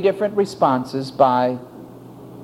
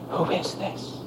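whispered Who is this?, rising at the end; hushed question; 11 dB quieter than usual. Acted. Disciple whispering to himself in awe. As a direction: breathe the question almost unheard.

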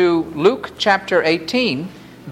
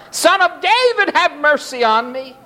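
insistent, very high plea; crying out more; 10 semitones higher than usual. A narrator's reading. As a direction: keep calling high over those silencing you. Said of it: "he cried out all the more".

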